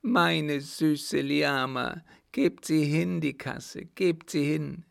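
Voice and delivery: high, slow, yearning wail; pleading madman. Acted. Mad son calling for his sweet beloved, pleading.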